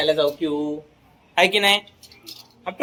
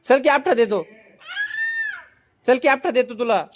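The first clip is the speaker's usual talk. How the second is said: coaxing; 8 semitones higher than usual. Real, not acted. Dad promising a screaming toddler a cracker, coaxing.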